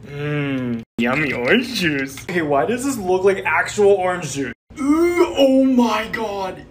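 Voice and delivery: ordinary delivery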